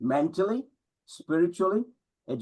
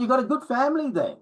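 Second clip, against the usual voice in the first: father's voice; 9 semitones higher, faster than usual. Retold or imitated.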